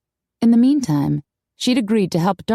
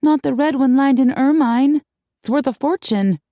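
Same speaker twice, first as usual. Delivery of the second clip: high-pitched, horrified disbelief; gasped dismay; 7 semitones higher, 3 dB louder than usual. A narrator's reading. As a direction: protest as if dreading the answer. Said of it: "Darcy gasped".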